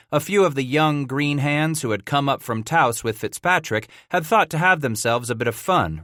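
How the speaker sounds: usual speaking voice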